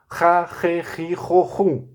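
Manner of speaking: "Ra, re, ri, ro, ru" with a throaty carioca R; carioca R syllables. Shown as a demonstration. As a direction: roll through the syllables with a guttural R. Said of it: "aquele R carioca". (sílabas com R carioca gutural)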